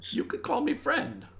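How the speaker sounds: high-pitched little creature voice; small squeaky creature. Acted. Creature gives the traveller a name to use, small and squeaky. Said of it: "it sounds small and squeaky".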